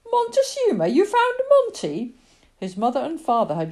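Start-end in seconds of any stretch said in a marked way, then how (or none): 0.1-2.2 excited. Acted. Boy hears his lost cat found.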